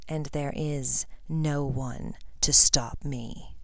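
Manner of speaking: slow, whispered threat; slow cold menacing whisper. Acted. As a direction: drop to a chilling hush. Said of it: "His cold voice pierced".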